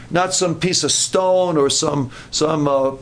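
ordinary delivery

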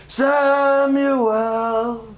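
high, long-drawn call; familiar calling voice. Shown as a demonstration. Preacher showing the voice Samuel knows, long high call. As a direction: hold the name out slowly on one high note. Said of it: "The voice I know is".

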